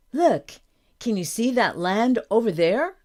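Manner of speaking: loud, lifted, rising question; excited reveal. A narrator's reading. Daughter showing her father the land, excited. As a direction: point it out with eager energy.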